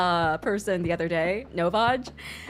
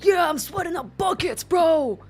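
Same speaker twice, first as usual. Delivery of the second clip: higher, pumped-up grunting bro; gym bro hype; 7 semitones higher, 3 dB louder than usual. Acted. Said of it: "I'm like".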